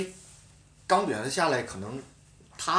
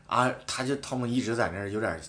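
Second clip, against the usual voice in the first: normal talking voice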